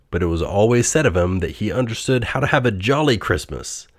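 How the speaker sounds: usual speaking voice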